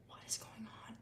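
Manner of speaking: tiny unvoiced-sounding whispered aside; stunned whisper. [name] watching Milo sob, hushed mock-stunned whisper. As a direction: whisper it under your breath, barely audible.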